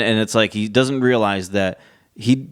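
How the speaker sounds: ordinary talk, nothing marked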